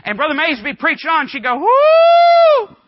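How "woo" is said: long, high, very loud "Woo!"; old lady's shouted whoop. Retold or imitated. Old lady shouting during the preaching, ecstatic whoop. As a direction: whoop it out, held on one high note. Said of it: "a little old lady got to shouting".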